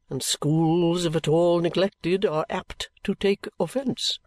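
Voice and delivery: theatrical patriarch bestowing his sacrifice; pompous old father. A narrator's reading. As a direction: draw out every word with self-important solemnity.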